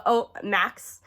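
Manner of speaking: ordinary talk, nothing marked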